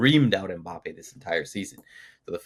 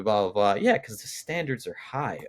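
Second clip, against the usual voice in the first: ordinary talk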